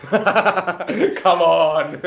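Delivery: laughing come on. Real, not acted. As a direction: laugh through it in disbelief. Laughing disbelief.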